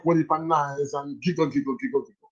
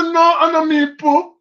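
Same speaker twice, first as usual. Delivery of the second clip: a lightly voiced kid protesting, higher and slower; child denying; 8 semitones higher, 4 dB louder, slower than usual. Boy denying to the teacher he pooped, flustered and higher. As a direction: plead innocence like a flustered child.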